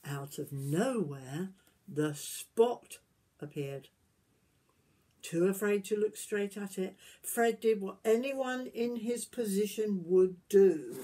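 gentle storybook reading voice; reading to children. Acted. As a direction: tell it like a bedtime story to little ones. Reading picture book aloud.

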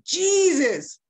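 high, strained cry of a dying man; desperate cry. Retold or imitated. Dying man cries out to Jesus for help, high desperate call. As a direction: cry out the name as a last hope.

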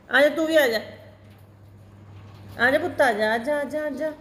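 high, sweet calling to a pet bird; coaxing pet voice. Real, not acted. Coaxing the angry second parrot. (ਤੋਤੇ ਨੂੰ ਪਿੰਜਰੇ 'ਚੋਂ ਬੁਲਾਉਂਦੀ, ਉੱਚੀ ਮਿੱਠੀ ਆਵਾਜ਼)

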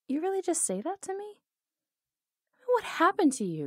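can't believe what she heard; incredulous. Acted. Outlaw shocked by her lover's tame words, pitch shooting up.

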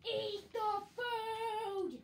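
high, loud, slow sing-song yell; drawn-out command. Pretend. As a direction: holler it playfully, stretching the last word. Urges hungry teammate in game.

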